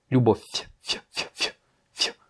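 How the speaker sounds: "любовь" ending in fy, then isolated soft "ф"; near-voiceless f with tiny y glide on "ф"; soft final f. Shown as a demonstration. Teacher isolates the ending of любовь, soft f. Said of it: "Tu vois, ça fait ф".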